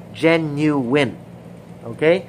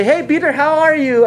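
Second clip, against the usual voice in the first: high, loud casual hello; breezy greeting; 8 semitones higher, 5 dB louder than usual. Acted. Jesus ignoring the denial, breezy high greeting. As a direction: toss off a cheery greeting. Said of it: "Jesus did not say".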